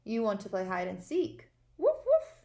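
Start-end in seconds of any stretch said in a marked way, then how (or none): 1.8-2.3 loud high yappy puppy woof; dog sounds. Acted. Teacher voicing Biscuit for the class, loud high bark. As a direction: bark it out like an excited little dog. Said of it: "all of my dog sounds".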